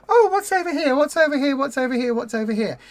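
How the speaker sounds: high, excited puppy voice fading down; voicing an easily distracted puppy. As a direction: start bright and curious, then trail off. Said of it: "and then it goes".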